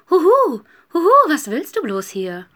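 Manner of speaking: high, loud hoots into a puzzled question; hooting owl. A narrator's reading. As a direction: hoot twice, then question the stranger loudly. (rufende, fragende Eule)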